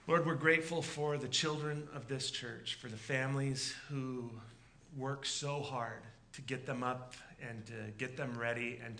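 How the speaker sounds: soft, hushed prayer voice. Acted. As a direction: pray quietly and earnestly. Pastor leads a prayer.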